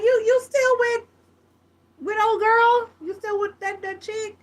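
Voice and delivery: high, cheery mock voice of a guy greeting a friend; friendly greeting. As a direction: greet your buddy in a high, bright put-on voice. Friend asking about girlfriend.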